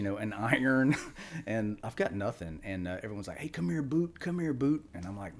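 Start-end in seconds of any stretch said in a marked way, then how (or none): 3.4-4.8 higher-pitched imitation of seniors calling; imitated summons. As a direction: lightly mimic summoning the new guy.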